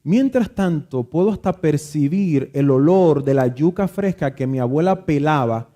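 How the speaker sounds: ordinary talk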